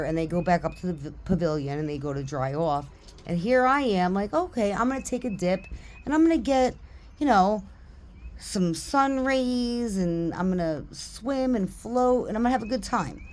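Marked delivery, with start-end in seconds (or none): 4.3-13.2 high, lilting, leisurely daydream; carefree self-quote. Retold or imitated. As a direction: play up a blissfully carefree plan. Acts out carefree plan before trouble.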